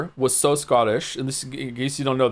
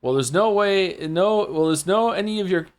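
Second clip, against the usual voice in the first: high-pitched mock Scottish governor; amateur Scottish accent; attempted Scottish accent; 4 semitones higher than usual. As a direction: dismiss them in a rough Scottish accent. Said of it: "For Scottish, you have to talk faster".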